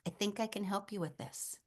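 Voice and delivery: soft bedside reassurance; gentle hushed offer. Retold or imitated. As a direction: offer comfort very quietly. Retold gentle offer to grieving woman.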